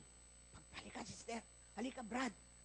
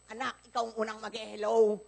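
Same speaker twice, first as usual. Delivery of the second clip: normal talking voice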